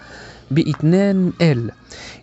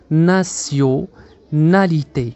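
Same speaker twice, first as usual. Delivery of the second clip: "nationalité" very slowly, broken into parts; drawn-out teaching breakdown of "nationalité"; syllable by syllable; much slower than usual. Shown as a demonstration. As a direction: split "nationalité" as na-syo, na-li-té with pauses. Teacher splits word into syllables.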